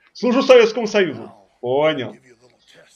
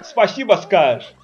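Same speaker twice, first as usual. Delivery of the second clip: ordinary delivery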